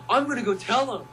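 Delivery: mock fan rushing to spill a secret; eager gossip. Fans on her post, teasing and eager. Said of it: "people on her status were like".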